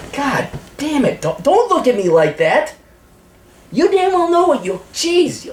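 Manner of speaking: very high-pitched disgusted outburst; exasperated dog scolding. Real, not acted. Scolding dog over a fart.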